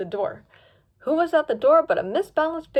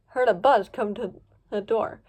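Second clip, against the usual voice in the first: plain reading voice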